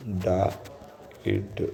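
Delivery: डाइड drawn out very slowly as daa, then id; broken into parts. Shown as a demonstration. Teacher sounding out the word for students, डाइड slowly split in parts. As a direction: sound out डाइड piece by piece, softly. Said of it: "ये डाइड लिखा है".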